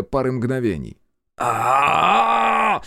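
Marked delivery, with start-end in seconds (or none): 1.4-2.8 long, high, loud yell; drawn-out cry of helpless rage; frustrated scream. A narrator's reading. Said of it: "выкрикнул я".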